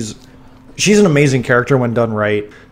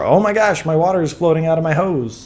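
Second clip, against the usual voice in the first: high-pitched mock astonishment; Stan Lee cameo; 5 semitones higher than usual. Host imitating a film cameo for co-host, mock amazement. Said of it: "what happened with Stan Lee in".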